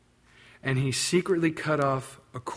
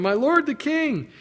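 high, slow call; crying out; 11 semitones higher, 3 dB louder than usual. Acted. David cries out to Saul, calling loud. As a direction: reach him from afar. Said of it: "he cries out to him".

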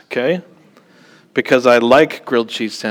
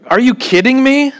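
much higher, louder disbelief; amazed, raised-voice aside to the congregation; incredulous; 12 semitones higher, 4 dB louder than usual. Real, not acted. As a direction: toss it out like you can't believe it.

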